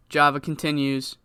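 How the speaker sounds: ordinary talk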